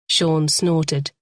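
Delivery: ordinary delivery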